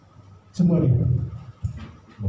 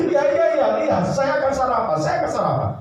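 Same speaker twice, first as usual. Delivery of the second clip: laughing; 9 semitones higher, faster than usual. Acted. Laughing husband.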